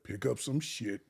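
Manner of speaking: low voice sinking to near-whisper; hushed retold instruction, fading away; conspiratorial stepdad. Stepdad repeating his fighting advice to the boy, hushed.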